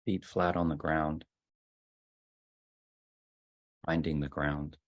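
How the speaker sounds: soft, unhurried voice with long silences; guided meditation. Real, not acted. Leader settling the group into ten minutes of sitting, guided meditation. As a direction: ease them gently into stillness, phrase by phrase.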